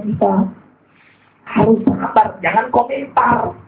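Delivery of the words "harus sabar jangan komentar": usual speaking voice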